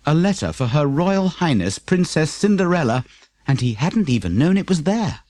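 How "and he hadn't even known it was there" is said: usual speaking voice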